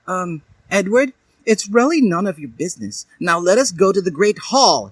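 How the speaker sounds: high, quick dismissive snip; snippy. A narrator's reading. Dali snips at jealous Edward, high and quick. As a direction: brush Edward off curtly. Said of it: "she snipped".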